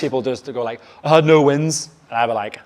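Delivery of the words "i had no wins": loud, raised imitation of moaning; mock client complaint. Clients reporting back on their week, loud mock complaint. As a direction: blurt it out like a defeated client.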